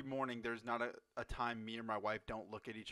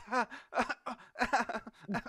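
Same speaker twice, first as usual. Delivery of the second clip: high, loud forced ha-ha-ha; pained mock laugh; 10 semitones higher, 7 dB louder, slower than usual. Kids hurting as they laugh, pained fake laughter. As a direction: imitate laughing while it hurts. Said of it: "they're gonna laugh in pain".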